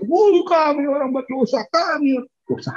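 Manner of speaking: high anguished cry of sinners; crying out in repentance. Retold or imitated. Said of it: "suara itu makin lama makin besar". (seruan tinggi penuh penyesalan)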